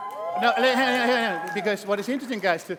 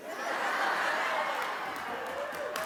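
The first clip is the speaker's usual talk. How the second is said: breathy high laugh; 8 dB quieter than usual. Real, not acted. Laughs at own joke.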